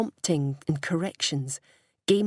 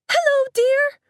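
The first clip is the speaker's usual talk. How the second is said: mock mum's shrill high falsetto, much louder; 9 dB louder than usual. Marnie imitating her mum's greeting to the girls, loud shrill falsetto. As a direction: squeal it in a creepy sugary falsetto. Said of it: "She said it in a shrill falsetto".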